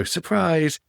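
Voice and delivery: high, light "Surprise."; mock surprise. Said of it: "walk out of the cupboard and go".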